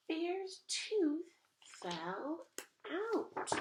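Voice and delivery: building up to a high loud burst; excited reveal. A narrator's reading. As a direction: announce it like big news to kids. Climax of the story.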